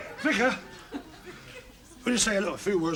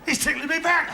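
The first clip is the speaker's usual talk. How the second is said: being tickled; 6 semitones higher than usual. Pretend. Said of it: "I'm tickling him".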